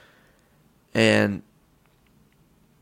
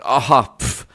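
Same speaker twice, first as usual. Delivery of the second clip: retold laugh; 5 semitones higher, 7 dB louder than usual. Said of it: "finished laughing".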